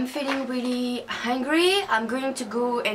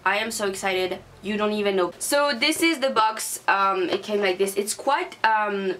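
ordinary talk, nothing marked

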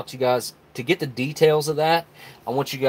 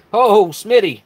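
higher, louder happy exclamation; excited shout-out; 9 semitones higher, 8 dB louder, slower than usual. Real, not acted. Streamer greets Smitty's super chat, excited shout-out. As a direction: call out to a friend with delight.

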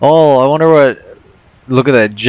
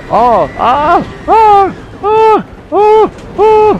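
sledding downhill screams; much slower than usual. Real, not acted. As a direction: scream through the drop. Screaming on coaster drop.

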